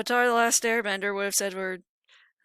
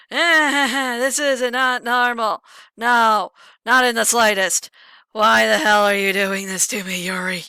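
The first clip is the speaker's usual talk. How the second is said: much louder, overwhelmed and desperate; frantic Yuri outburst; 10 dB louder, slower than usual. Acted. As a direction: shout it like she's losing her mind. Yuri losing control, voiced.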